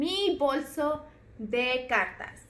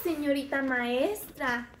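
ordinary talk, nothing marked

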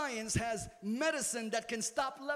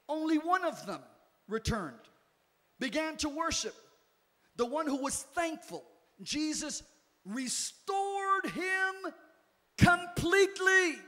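rising preaching; 4 semitones higher, 5 dB louder than usual. Acted. Preaching build.

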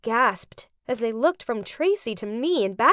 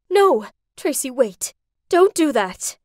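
mildly raised, urgent protest; yelled plea; 5 dB louder than usual. A narrator's reading. Mom tries to stop Tracy, yelled plea. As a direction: call out to stop her, not full force. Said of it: "my mom yelled".

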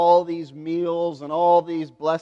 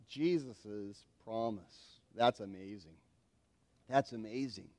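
very soft, much lower voice; hushed awe; 9 semitones lower, 12 dB quieter than usual. Real, not acted. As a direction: marvel quietly, almost to yourself. Marvels at the promise.

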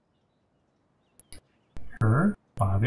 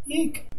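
a sudden, much higher squeal of fright; frightened shriek. Acted. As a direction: yelp in panic at the name. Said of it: "calm yourself".

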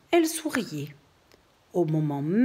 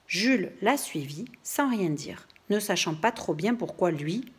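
ordinary delivery, nothing marked

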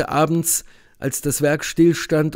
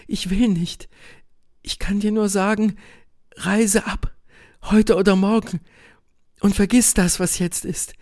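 higher woman's voice, pleading and halting; tormented Helene; 7 semitones higher than usual. A narrator's reading. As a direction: plead with him to leave, effortfully. (gequälte Helene, hohe flehende Stimme)

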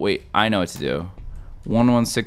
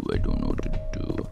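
deep mock voice; 6 dB quieter, faster than usual. Shown as a demonstration.

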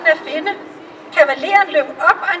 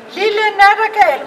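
put-on the girl calls up into the branches, higher and louder; calling out loud; 5 semitones higher, 5 dB louder than usual. The kitchen girl calls up to the nightingale, loud. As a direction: call up to the bird in the tree. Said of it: "råbte den lille kokkepige ganske højt".

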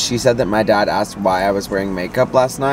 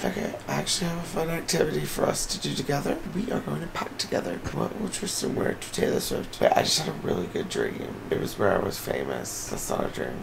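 soft, half-whispered sleepy rambling; drowsy rambling; 3 semitones higher, 8 dB quieter, faster than usual. Real, not acted. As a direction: ramble half asleep and hungover. Hungover, just woken in bed.

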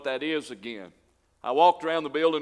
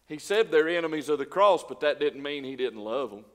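usual speaking voice

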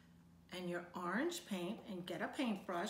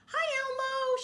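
much higher and louder happy greeting; cheerful cry; 8 dB louder than usual. Acted. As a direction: call out gleefully across the schoolyard. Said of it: "she cried".

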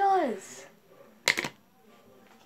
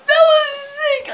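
high, helpless laugh into the words; real laughter; 13 semitones higher, slower than usual. Real, not acted. Friend reacting to the prank call, laughing hard. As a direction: crack up, stretching the words out.

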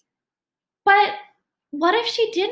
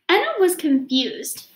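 plain reading voice

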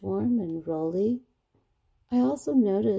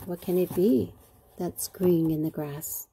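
ordinary delivery, nothing marked

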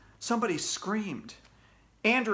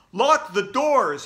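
loud, slow, raised command; shouted order; 7 semitones higher, 8 dB louder, much slower than usual. A narrator's reading. Mister Klutz to the whole school, shouting. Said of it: "he shouted".

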